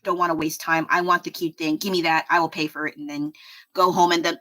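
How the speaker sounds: normal talking voice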